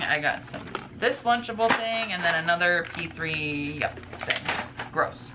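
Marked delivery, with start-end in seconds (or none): none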